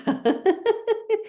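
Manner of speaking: high rapid-fire laugh; demonstrated laughter. Shown as a demonstration. Showing laughter before turning sound off, high laugh. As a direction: open with a high burst of laughing. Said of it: "loud laughing".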